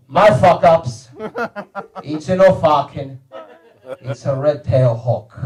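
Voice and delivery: put-on slow, low Italian-accented pronouncement; Italian father's accent. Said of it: "most fucked up Italian accent".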